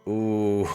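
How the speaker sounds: loud, drawn-out "ooh" into laughter; teasing ooh with laughter. Acted. Onlooker reacting to a jab, teasing laugh. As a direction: laugh out a big oooh.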